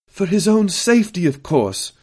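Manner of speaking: high-pitched indignant exclamation. A narrator's reading. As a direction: exclaim it so it rings round the chamber. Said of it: "voice was quite audible now, that exclamation echoing".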